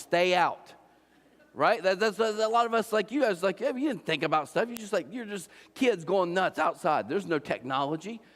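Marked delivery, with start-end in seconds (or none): none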